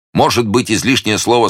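put-on amused young gallant; smiling. A narrator's reading. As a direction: answer with a playful smile. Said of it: "улыбаясь".

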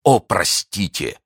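impassioned, lingering exclamation; imploring cry. A narrator's reading. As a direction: beg fervently for forgiveness. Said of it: "воскликнул он".

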